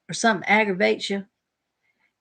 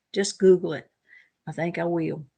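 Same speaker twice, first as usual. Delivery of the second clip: ordinary talk, nothing marked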